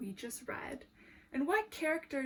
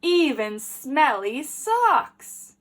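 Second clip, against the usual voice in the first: slow, louder punchline; slow loud reveal; 2 semitones higher, 13 dB louder, much slower than usual. A narrator's reading. Teacher revealing the smelly socks to the class, slow and louder. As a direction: land the funny word for the kids.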